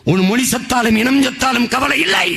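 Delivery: high-pitched accusing oratory; accusing declamation. Real, not acted. Orator reproaches people indifferent to their language, accusing declamation.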